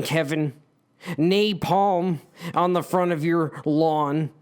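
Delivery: ordinary delivery, nothing marked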